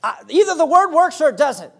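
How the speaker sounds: high, loud exasperated demand; fed up with waiting on the word; frustrated. Retold or imitated. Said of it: "And I was frustrated".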